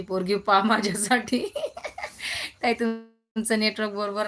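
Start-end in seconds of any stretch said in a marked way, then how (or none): 1.5-2.6 laughing. Real, not acted. Laughs at her own joke.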